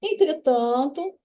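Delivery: Entretanto, drawn out slowly, rising; slow gloss. Shown as a demonstration. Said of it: "essa expressão que dá essa ideia de contrariedade". ("Entretanto" dito devagar, subindo no fim)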